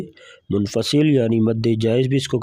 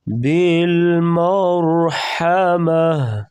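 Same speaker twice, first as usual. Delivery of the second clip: slow, melodic tajweed chant; Quran recitation; 4 semitones higher, much slower than usual. Shown as a demonstration. Teacher reciting for students, slow melodic tajweed. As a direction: draw each word out slowly in recitation. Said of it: "را کو پر پڑھیں گے موٹا".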